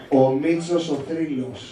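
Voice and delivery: low, very slow macho introduction; heavy tough guy. Shown as a demonstration. Coach modelling the contestant's entrance line, heavy and slow. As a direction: stretch out each word, low and weighty. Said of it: "σε θέλω βαρύ και ασήκωτο".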